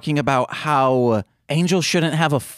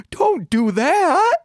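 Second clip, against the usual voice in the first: much higher, slow, timbre unlike own, thin squeak; mock Willow plea; slower than usual. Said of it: "she's like".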